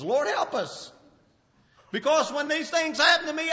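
usual speaking voice

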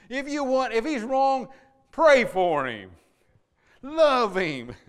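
loud, high preacher's cry; impassioned sermon delivery; fiery preaching. Acted. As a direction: preach it out full force to the church. Preaching at full force.

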